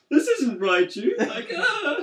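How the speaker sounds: high, loud comic panic; mock freak-out. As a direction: yelp it like a horrified kid, hammed up. Retelling his childhood freak-out.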